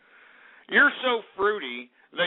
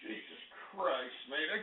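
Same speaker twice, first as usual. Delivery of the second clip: much softer, slower, drained; quiet groan; 4 semitones lower, 16 dB quieter, slower than usual. Acted. Host collapsing after his outburst, drained mutter. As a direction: sink back spent after the rant.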